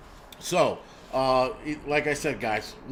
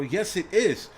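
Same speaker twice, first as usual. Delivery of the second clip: higher-pitched, stubborn comeback; retold insistence; 6 semitones higher, 3 dB louder, slower than usual. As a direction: re-enact digging in during a squabble. Retold argument with his wife.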